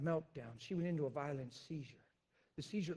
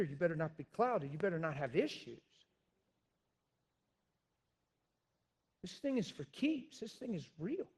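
louder, climbing higher as he warns; fiery preaching; 3 semitones higher, 6 dB louder than usual. Real, not acted. Pastor warning his congregation about spiritual warfare, fiery preaching. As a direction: hammer the warning home.

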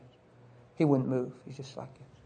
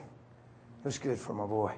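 ordinary delivery

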